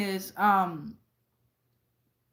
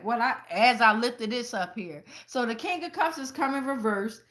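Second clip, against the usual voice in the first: ordinary talk, nothing marked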